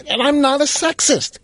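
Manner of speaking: imitating a friend's high, protesting denial; indignant denial.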